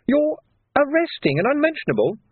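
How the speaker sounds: very high incredulous question; astonished. A narrator's reading. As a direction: question the sergeant in high-pitched disbelief. Astonished young constable questions sergeant.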